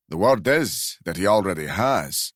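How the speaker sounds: put-on a light Glasgow accent; lightly voiced old Glasgow driver. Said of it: "a very powerful Glasgow accent".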